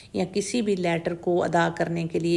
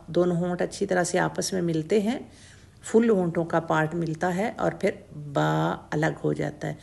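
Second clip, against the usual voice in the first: ordinary talk, nothing marked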